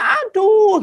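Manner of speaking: high-pitched mock cry of pain; imitated pained yell. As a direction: act out yelling from one blow. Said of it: "dia bisa teriak". (tiruan teriak kesakitan bernada tinggi)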